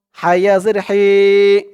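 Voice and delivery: normal talking voice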